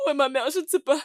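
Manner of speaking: tearful, insistent questioning; distraught crying. Acted. Daughter asks where her mother is, crying. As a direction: plead through tears to know.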